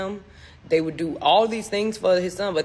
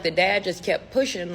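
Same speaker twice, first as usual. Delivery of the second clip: plain reading voice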